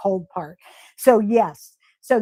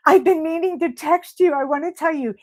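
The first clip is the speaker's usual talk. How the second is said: excited; 5 semitones higher than usual. Real, not acted. Greets a friend joining chat.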